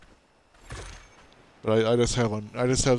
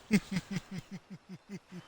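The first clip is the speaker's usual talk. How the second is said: giggle; 9 semitones higher, 7 dB quieter than usual. Real, not acted. Laughs at own stoner joke.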